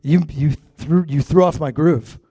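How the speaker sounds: chuckling playful rebuke; laughing tease. Real, not acted. Pastor teases son in the crowd, laughing. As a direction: laugh through the words. Teasing son who interrupted.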